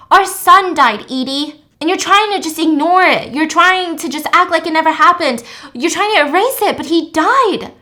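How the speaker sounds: loud, high, impassioned confrontation; anguished shouting. Retold or imitated. Will confronts his ex about their dead son, impassioned shouting. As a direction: hammer each accusation home, loud.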